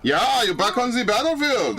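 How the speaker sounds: high-pitched mock German accent; bad German accent. Streamer mimics a game character on the battlefield, bad German accent. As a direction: imitate the character in a badly faked German accent. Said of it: "I can't do a German accent".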